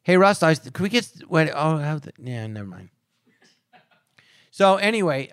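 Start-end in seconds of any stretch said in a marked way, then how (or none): 0.0-2.9 slurred rush fading out; hurried mumble, dying away at the end; unintelligible fast talk. Shown as a demonstration. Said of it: "I was unintelligible. You couldn't understand".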